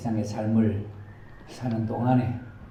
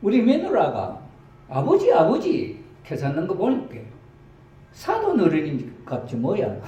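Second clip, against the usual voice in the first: much higher, louder Gyeongsang granny guessing; a country old woman talking about her daughter-in-law; Gyeongsang grandma; 9 semitones higher, 4 dB louder than usual. Acted. Grandma guesses Jesus is the in-law, in high Gyeongsang dialect. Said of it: "경상도 버전으로".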